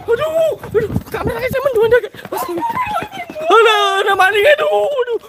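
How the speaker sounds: high frightened shrieks with laughter; panicked shriek. Real, not acted. Ghost hunt, panicked shrieking.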